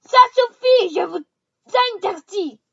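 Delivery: loud, high panicked cry; panicked shout. A narrator's reading. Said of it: "s'exclama ... pris de panique".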